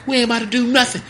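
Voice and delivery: high, loud mock scolding; scolding mom. Mom scolding her son after popping him upside the head.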